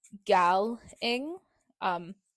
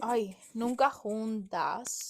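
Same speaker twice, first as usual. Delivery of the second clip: slow rolled Spanish accent on "Ay, nunca juntas"; 5 dB quieter, much slower than usual. Shown as a demonstration. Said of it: "you have to say it in a Spanish accent".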